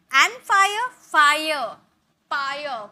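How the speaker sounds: "fire" repeated apart with a clear f onset; sounded-out F. Shown as a demonstration. Teacher showing students the consonant start, "fire" said word by word. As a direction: isolate "fire" so the f is heard. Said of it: "फ का sound आ रहा".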